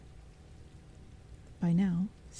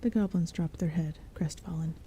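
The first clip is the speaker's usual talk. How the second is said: ordinary delivery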